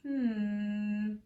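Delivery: plain reading voice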